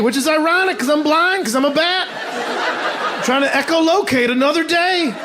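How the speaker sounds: bat voice. Acted. Comedian voicing the tired bat.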